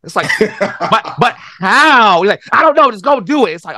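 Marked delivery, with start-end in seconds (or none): 0.4-2.2 loud, high-pitched mock protest; acted bewilderment. Young player questioning the coach's vague order, bewildered. 2.5-3.5 high, loud acted brush-off; mock exasperation. Coach brushing off a player's question, exasperated.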